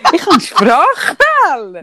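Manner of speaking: Sprachfehler said with a slushy lisped s. Real, not acted. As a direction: lisp the s sounds in Sprachfehler. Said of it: "am Lispeln heute".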